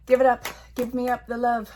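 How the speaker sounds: loud call. Real, not acted. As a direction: demand it boldly. Calling on Spirit for cards.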